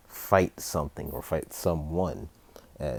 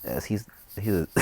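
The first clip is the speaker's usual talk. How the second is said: ordinary delivery, nothing marked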